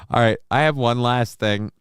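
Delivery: ordinary talk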